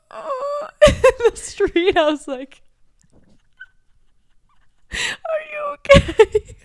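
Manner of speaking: high, slow, squeaked through tears; barely gets it out, laughing to tears; crying with laughter. Real, not acted. Recalling sister in the street, crying with laughter.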